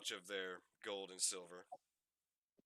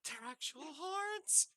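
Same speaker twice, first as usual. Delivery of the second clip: very high, slow squeal; slower than usual. Acted. Fairy girl squealing as she grabs the horns. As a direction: squeal it out, thrilled. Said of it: "is like".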